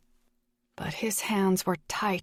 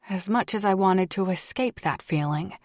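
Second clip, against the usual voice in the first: ordinary delivery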